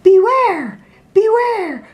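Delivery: high warning call; calling bees. Acted. Bees call a warning to the bear, high. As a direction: call the alarm, pitched high. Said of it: "called the bees".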